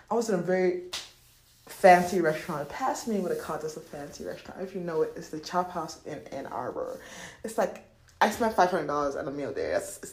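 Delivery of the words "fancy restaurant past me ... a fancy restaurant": normal talking voice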